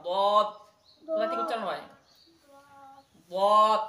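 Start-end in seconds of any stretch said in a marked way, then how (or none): 0.1-0.5 loud, crisp দদ from the side of the tongue; letter drill; Arabic letter demo. Shown as a demonstration. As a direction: spell out দদ firmly. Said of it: "দদ সাধারণ বলতে পারবে না".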